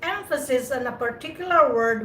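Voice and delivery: usual speaking voice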